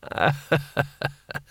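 weak staccato ha-ha-ha; forced, hollow little laugh; nervous laugh. Acted. Rincewind humouring the emperor, weak forced laugh.

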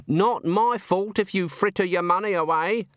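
lightly voiced Granny Weatherwax, tart; Granny, sharp. A narrator's reading. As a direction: tart old woman scolding.

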